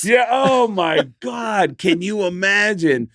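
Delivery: slow, high-pitched amazed exclamation; appalled disbelief. Real, not acted. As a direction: stretch it out in real disbelief. Reacting to the imagined disaster.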